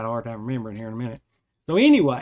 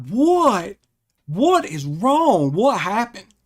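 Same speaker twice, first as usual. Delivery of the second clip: louder, higher, urgent questioning; alarmed questions; 7 semitones higher, 6 dB louder, slower than usual. Retold or imitated. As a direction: demand answers as the floor moves. Alarmed, asking friend what's wrong.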